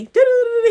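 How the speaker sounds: high-pitched nonsense babble for his talking; imitated endless yapping; mock chatter. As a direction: rattle off high mocking doo-doos. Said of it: "he, he be talking".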